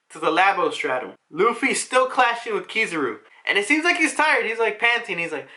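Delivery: usual speaking voice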